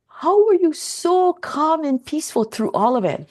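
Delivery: acted-out amazement; retold surprise. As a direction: imitate an astonished neighbor asking. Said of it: "he was just so surprised".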